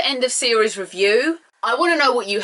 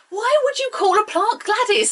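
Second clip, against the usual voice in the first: high, incredulous why-would-you; exasperated disbelief; 13 semitones higher than usual. Real, not acted. Reviewer baffled by a plant named Gladys, incredulous.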